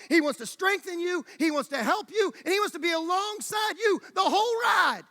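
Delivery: rising, driving sermon crescendo; impassioned preaching. Real, not acted. Preacher builds to a climax.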